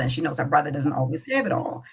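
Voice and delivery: ordinary talk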